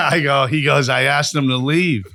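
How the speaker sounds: loud, high, laughing retelling. Real, not acted. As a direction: crack up while delivering the punchline. Laughs while retelling his father's punchline.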